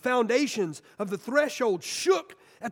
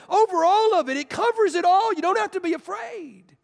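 louder, higher, full-force proclamation; rising preaching shout; 8 semitones higher, 5 dB louder than usual. Real, not acted. Preacher to the congregation, a triumphant shout. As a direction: build to a triumphant cry.

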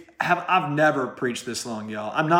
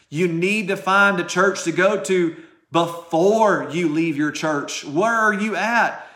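emphatic preacher building to a higher pitch; forceful preaching; 5 semitones higher, 4 dB louder than usual. Real, not acted. Preacher warning church-leavers nobody is covering them, increasingly forceful. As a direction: hammer the warning home, starting slow and drawn out.

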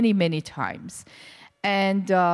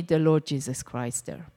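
ordinary delivery

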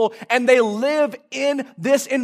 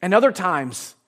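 usual speaking voice

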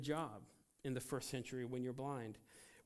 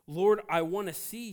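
higher and louder plea; pleading cry; 5 semitones higher, 11 dB louder than usual. Acted. Blind man begging Jesus for sight, loud pleading cry. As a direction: reach out with longing.

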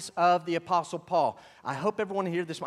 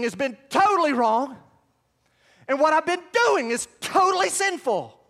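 higher, louder, urgent outpouring; anguished realization; 8 semitones higher, 5 dB louder than usual. Acted. Preacher voicing Saul's shock at meeting Jesus, impassioned. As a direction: cry it out as if your world just broke.

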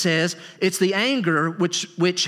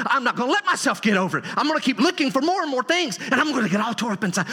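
quick, raised-pitch mock determination; acted stubborn grudge; 6 semitones higher, faster than usual. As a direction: play someone working themselves up, high and hurried. Voices a person nursing a grudge.

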